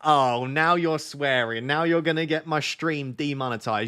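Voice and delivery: loud, slow, drawn-out telling-off; mock scolding. Streamer to partner who swore on stream, mock scolding. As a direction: scold with exaggerated, stretched-out exasperation.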